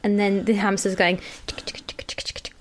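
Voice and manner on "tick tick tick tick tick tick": whispered ticking. Pretend. Hamster wheel noise imitated.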